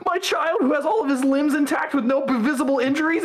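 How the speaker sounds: very high, sarcastic falsetto with a laugh at the end; mock hysterical mother. Ridiculing the wife's question about her intact child, very high. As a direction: overplay the frantic mom.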